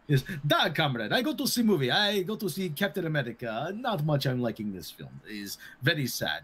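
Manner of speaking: Russian comrade voice answering about the sock people; mock Russian accent. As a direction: ham up the broken Russian English. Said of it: "I pulled a Russian accent out".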